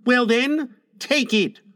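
high-pitched challenge, "take it" in two parts; boy's dare. A narrator's reading. Son challenging father over medicine, high boyish dare. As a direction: dare him like a child. Boy dares his father.

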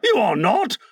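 high, escalating denial; captain's frantic contradiction; hoarse cry. A narrator's reading. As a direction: cry it out, rising to a high pitch. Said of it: "Hook cried hoarsely".

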